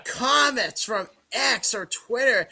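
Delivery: usual speaking voice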